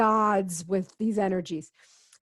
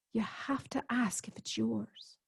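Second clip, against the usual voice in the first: hushed; 10 dB quieter than usual. Retold or imitated. Therapist's advice retold.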